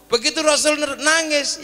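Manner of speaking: ordinary delivery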